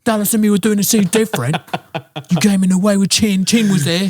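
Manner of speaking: high-pitched mocking impression voice; squeaky put-on voice, fast and whiny. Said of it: "he's like".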